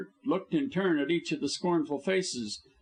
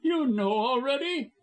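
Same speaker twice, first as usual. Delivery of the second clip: nervous; 11 semitones higher, 4 dB louder than usual. A narrator's reading. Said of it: "in nervous haste".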